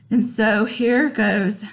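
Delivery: ordinary talk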